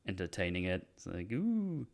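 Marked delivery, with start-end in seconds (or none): 1.3-1.9 high, soft mock-flirty coo; a small teasing ooh. Palm reader entertained by the guy, mock-flirty. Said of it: "It's a bit flirty".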